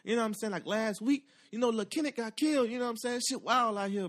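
high, animated cousin voice; cousin's story voice. Retold or imitated. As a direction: tell it up high and wound up. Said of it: "He like".

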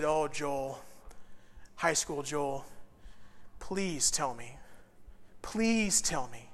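soft, subdued appeal; a touch of hushed begging; quiet pleading. Retold or imitated. Pleading with his younger self.

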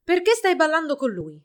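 high, loud jealous demand; shouted accusation. A narrator's reading. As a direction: demand an answer indignantly. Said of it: "gridò".

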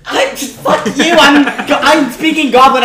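high, indignant outburst; outraged protest. Real, not acted. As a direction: protest loudly at the unfair call. Player protests the GM's ruling.

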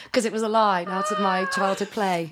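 put-on Australian accent, hammed up. Host playing Australian for co-host, in a hammed-up accent. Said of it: "I have to say that was pretty good".

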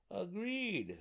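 high-pitched gnome councilor. A narrator's reading. Second gnome agreeing with the warden, high gnome voice. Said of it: "said the other".

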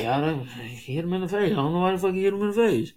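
fast put-on voice of a whining fan, lightly done; mock complaining fan. Mocking Ravens fans who would complain, quick put-on whine. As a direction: mimic a fan grumbling about the hit. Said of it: "be like".

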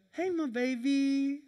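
put-on a father murmuring to his little girl; tender morning greeting. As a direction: coo it gently to a sleepy baby. Father waking his daughter.

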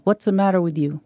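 ordinary delivery, nothing marked

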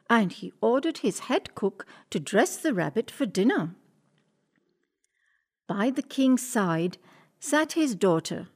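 plain reading voice

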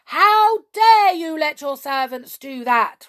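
slow, loud, high furious goblin roar; raging. Acted. Red goblin rages at Hop over the tickling servants, furious and loud. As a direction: let the rage boil over at the wizard. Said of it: "raged the goblin".

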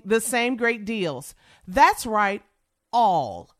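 normal talking voice

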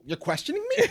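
very high, incredulous question; mock offended. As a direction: act affronted at being doubted, for fun. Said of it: "I'm gonna take offense to it".

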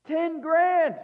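ten grand thrown out high and loud; excited emphasis. Real, not acted. Preacher marveling at the huge debt, high and loud. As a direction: marvel at the sum. Exclaiming the amount.

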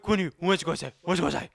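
imitated babbling at a pet; pet baby talk. Imitating talk to Quinn, pet baby talk. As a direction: play at babbling questions to an animal. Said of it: "The way my mom talked to Quinn".

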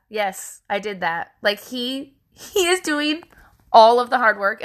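ordinary delivery

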